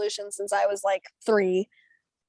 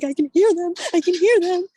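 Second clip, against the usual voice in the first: higher and louder, urgent; frantic; 7 semitones higher, 6 dB louder than usual. Retold or imitated. Teen urgently tells her mom she can hear the judges, higher and louder. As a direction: tell her urgently, barely holding it in. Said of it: "I'm like".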